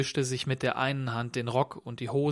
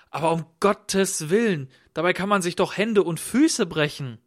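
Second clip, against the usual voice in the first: louder, higher cry of dismay; alarmed host; 5 semitones higher, 7 dB louder than usual. A narrator's reading. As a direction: exclaim in alarmed concern. Host alarmed at stranger's falls. (bestürzter, lauter Ausruf)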